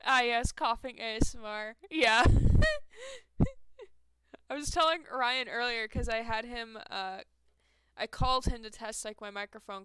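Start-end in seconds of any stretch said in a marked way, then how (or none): 2.3-3.8 high, loud cackle; shrieking laugh. Real, not acted. Laughing hard at the chat's coughing joke, loud and high.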